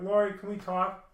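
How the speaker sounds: calling out to Lori. Retold or imitated. Calling to girlfriend entering house.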